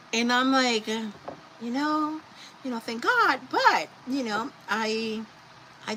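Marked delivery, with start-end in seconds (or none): none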